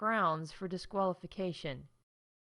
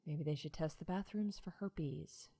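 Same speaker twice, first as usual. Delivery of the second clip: whisper; 4 semitones lower, 8 dB quieter than usual. Acted. Snide aside during park announcement.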